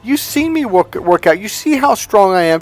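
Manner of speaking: high, insistent listing; retold incredulous protest. Fighter reminding girlfriend of his training, incredulous. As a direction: pile up the proof in disbelief.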